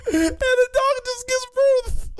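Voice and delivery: high, slow, weeping laughter; crying with laughter mid-sentence; laughing to tears. Real, not acted. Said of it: "He's got real tears going on right now".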